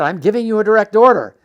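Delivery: loud, high, fast re-enacted command; raving officer pulling rank; yelled order. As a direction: yell it like a major losing control. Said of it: "He screamed and yelled at me".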